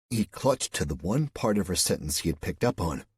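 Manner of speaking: plain reading voice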